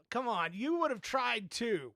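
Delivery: ordinary talk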